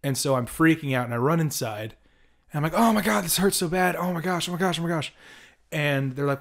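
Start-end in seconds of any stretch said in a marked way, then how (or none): 2.8-5.1 high-pitched mock wailing in pain; acted panic. Storyteller as his stung younger self running inside, mock panic. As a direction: imitate a kid freaking out, pitched up.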